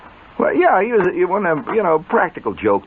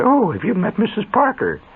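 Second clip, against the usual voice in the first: lightly voiced society hostess, higher; prissy hostess; 11 semitones higher than usual. Mother introduces her guest, a hint of a prissy hostess voice. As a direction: play the genteel lady of the house. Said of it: "my mother didn't talk like that".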